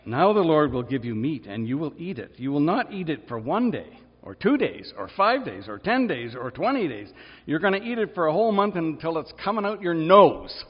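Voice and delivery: mildly stern, building with each number of days; a fed-up parent listing the days, rising; understated mock exasperation climbing to a punchline; irritated God. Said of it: "It makes him a little irritated".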